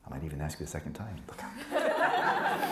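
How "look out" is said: laughing. Real, not acted. Teasing warning to student.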